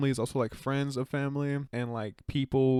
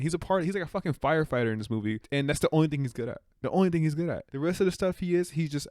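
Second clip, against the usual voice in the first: exasperated rant, higher and fast; worked up; 5 semitones higher, 3 dB louder, faster than usual. Real, not acted. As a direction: rant faster and higher, getting carried away. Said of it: "I gotta calm down".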